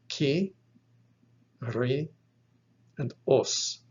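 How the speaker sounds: Kyrios broken into ki, ri, os with long pauses; syllable by syllable. Shown as a demonstration. Teacher counting the three syllables, syllable by syllable. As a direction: separate ki, ri and os. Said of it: "Notice you have three syllables".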